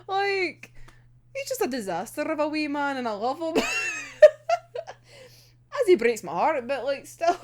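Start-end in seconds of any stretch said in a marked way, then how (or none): 3.7-4.9 very high delighted shriek; shrieking laugh. Real, not acted. Fan shrieking with laughter at the disaster of a man.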